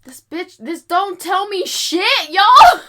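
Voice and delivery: high, loud, laughing outburst of exasperation; slow, then burst out yelling through a laugh; laughing mad. Real, not acted. Tarot reader venting at a useless card to her viewers, laughing and shouting. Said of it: "I'm so mad".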